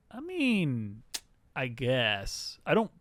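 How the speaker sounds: drawn-out, grudging concession climbing high at the end; reluctant doubt. Acted. Frey answering the claim about the gold key, grudgingly doubtful. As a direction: stretch it out sceptically, pitch lifting at the close.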